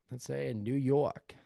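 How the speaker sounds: slow, attempted New York accent, half-butchered; a playful, amateur New York accent. Shown as a demonstration. Showing the realtors his best New York accent, a playful amateur try. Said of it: "That was my best New York accent".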